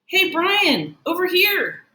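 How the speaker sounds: put-on boy calling a friend to his table; calling over. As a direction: wave him over with your voice. Justin waves Brian over.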